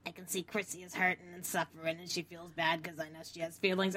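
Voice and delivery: soft, breathy-sounding mock of Nancy; hushed mock Nancy. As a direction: murmur it with fake tenderness. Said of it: "Nancy's like".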